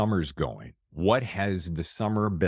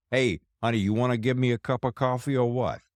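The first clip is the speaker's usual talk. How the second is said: put-on movie-style Brooklyn accent, pitched up; imitated Brooklyn accent; 5 semitones higher than usual. Host acting out waking up asking wife for coffee, mock Brooklyn accent. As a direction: put on a demanding Brooklyn husband. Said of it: "set in Brooklyn".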